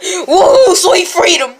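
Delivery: loud, high cheer with a laugh; triumphant laughing yell; joyful whoop. Acted. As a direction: whoop like you just escaped. Freed from the fridge.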